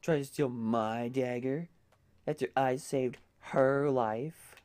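hammed-up sassy prince. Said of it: "I like how sassy the prince can be".

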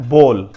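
بول as "bol", pitched low; one long o in بول; corrected pronunciation. Shown as a demonstration. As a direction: say بول with no "ow" glide. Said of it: "جو correct pronunciation ہے".

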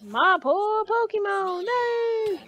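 high, drawn-out mock lament; slow whiny-pitched wail, climbing to the end; imitating a game character. Player mimicking how the trainer says his one line, drawn-out mock lament. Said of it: "Or as he says it".

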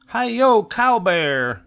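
high, loud cowboy whoop; excited cry. A narrator's reading. Cub cries out riding through the tulips, exhilarated. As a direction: whoop like a child playing cowboys. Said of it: "cried Miranda".

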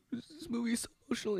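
high, sobbing imitation of a grown man; fake-weep the quote; hammed-up blubbering; mock crying. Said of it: "He started crying".

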